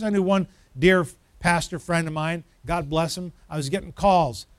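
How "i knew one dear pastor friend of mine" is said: ordinary talk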